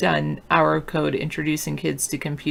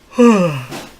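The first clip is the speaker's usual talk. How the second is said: heavy sigh; 2 semitones higher, 11 dB louder than usual. Real, not acted. Loud sigh after long pause.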